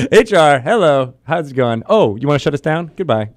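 mock address to the boss; mock greeting.

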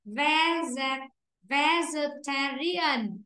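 Vegetarian, slowly syllable by syllable, stress on ta; slow and clear so the class can copy it; slow model pronunciation. Shown as a demonstration. Teacher modelling a word for pupils, slow and clear. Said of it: "Bạn nghe lại nào".

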